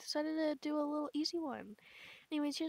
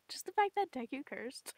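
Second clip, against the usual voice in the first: normal talking voice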